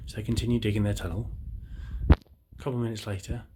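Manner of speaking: ordinary talk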